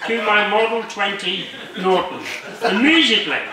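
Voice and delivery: reading while giggling; fighting back laughter as you read; laughing through it. Real, not acted. Club member reading a joke letter aloud, giggling. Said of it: "I hope you know what this is all about".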